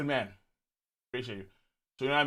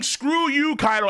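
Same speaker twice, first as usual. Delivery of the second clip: slightly raised mock yell; retold yell, lighter; 7 semitones higher than usual. Streamer quoting Big Mom again, a half-hearted mock yell. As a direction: toss the battle cry off half-heartedly. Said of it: "She's yelling".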